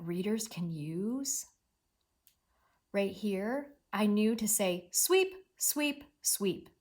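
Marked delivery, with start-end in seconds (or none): 4.9-6.7 three "sweep"s with small gaps; first "sweep" lifted, the others falling away; sweeping motion. Shown as a demonstration. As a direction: say "sweep" in even broom strokes. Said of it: "in kind of almost a sweeping motion".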